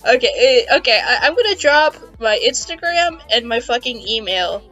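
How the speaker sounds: usual speaking voice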